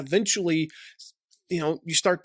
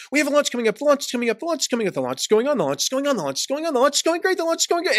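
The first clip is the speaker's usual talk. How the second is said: rapid rattle climbing ever higher; escalating mock hype; 8 semitones higher, much faster than usual. Marketer bombards affiliates after months of silence, escalating mock hype. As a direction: pile up the promo chatter, pitch rising to a squeak.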